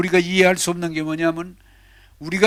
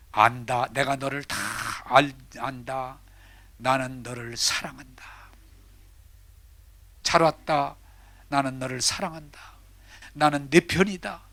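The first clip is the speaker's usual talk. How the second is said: lower, soft divine reassurance; voice of God; 4 semitones lower, 4 dB quieter than usual. Acted. God answers the weeping woman, low and soft. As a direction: answer her gently as God. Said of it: "하나님이".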